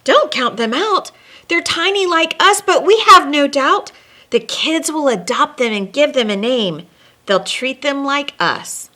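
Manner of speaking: rally for the little reindeer; elves shouting. Acted. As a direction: scout elves cheering on the tiny reindeer, high and loud. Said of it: "The scout elves then shouted".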